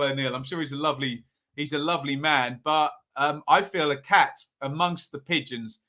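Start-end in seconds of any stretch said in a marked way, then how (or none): none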